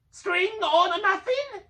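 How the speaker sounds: high, loud, slow creature shriek. A narrator's reading. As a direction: shriek two guesses at once. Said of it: "shrieked Gollum".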